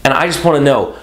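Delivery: usual speaking voice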